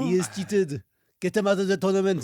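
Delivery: loud, high indignant French voice; French accent. Retold or imitated. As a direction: protest in a big French accent. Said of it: "Madame Beauxbatons from, um, s-, Harry Potter".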